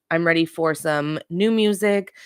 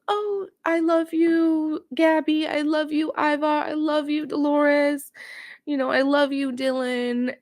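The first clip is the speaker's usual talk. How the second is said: slow, sugary, much higher fake-sweet voice; mocking Danielle; 10 semitones higher than usual. Vlogger mocks Danielle gushing to every houseguest, slow high syrupy voice. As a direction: drag it out like a gushing fake friend. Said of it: "she goes around and, like, goes".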